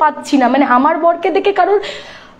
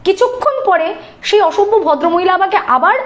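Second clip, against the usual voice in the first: ordinary talk, nothing marked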